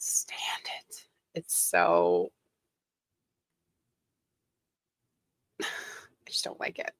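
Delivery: hushed complaint; whispering. Real, not acted. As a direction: whisper it under breath.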